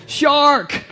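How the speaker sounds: tourist yell. Retold or imitated. Pastor imitating a tourist mistaking a dolphin, mock yell. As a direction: cry out like a tourist spotting a fin. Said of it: "sees a dolphin and yells".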